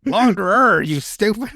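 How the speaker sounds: mocking yell. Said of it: "mock quote of the game character".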